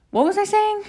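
high squeaky question. Real, not acted.

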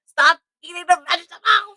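loud, high-pitched scolding shout; imitated mom yelling. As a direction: yell like an exasperated mom at the kids. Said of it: "like, yelling at".

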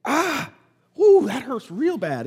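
high yelp of pain, then moaning complaint; hammed-up cry of pain. Joke patient Tom yelps when the doctor prods, hammed-up pain.

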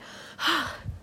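long breathy sigh of relief; big unvoiced relieved exhale. Real, not acted. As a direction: let it all out in a sigh.

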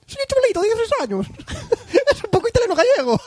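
italiano agudo y burlón, entre risas; acento de Luigi. Pretend. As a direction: lee el correo como Luigi italiano. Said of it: "con el acento de Luigi". (acento italiano de Luigi entre risas)

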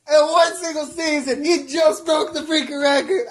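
loud, thrilled outburst; excited shouting. Real, not acted. Friend shouting to the group about Curry's record, excited yell.

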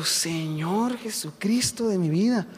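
slow, drawn-out plea to God; overwhelmed plea. Retold or imitated. Overwhelmed minister praying after news of the coma, slow dismayed plea. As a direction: stretch each word in comic dismay.

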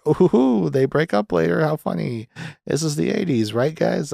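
high, hammed-up sarcastic delight; mock a corny joke with phony glee; mocking fake amusement. Mocking a dated eighties joke, sarcastic fake amusement.

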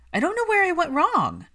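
a nanny at her wit's end; exasperated nanny giving up; despairing nanny. A narrator's reading. Nanny despairs over failed efforts.

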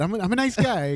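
squeaky, high protest; high mock voice. Joking self-defense, high voice.

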